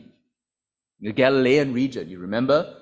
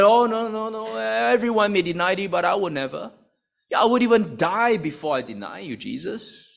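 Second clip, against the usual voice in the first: high, protesting bravado; acted-out cocksure pledge, pitched up; boastful bravado; 7 semitones higher than usual. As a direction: swear loyalty with overconfident pride. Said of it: "Where was that bravado that he exhibited earlier?".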